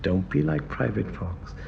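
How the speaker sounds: low, soft, sultry radio voice; sultry Sally. Retold or imitated. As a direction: purr it low like a seductive broadcaster. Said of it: "she had the lowest register of any woman".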